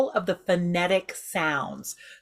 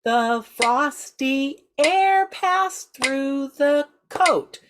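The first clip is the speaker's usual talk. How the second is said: rhythmic spoken line, exaggerated inflection; 5 semitones higher, much slower than usual. Shown as a demonstration. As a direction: speak it to the metronome, letting pitch rise and fall. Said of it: "Say it again".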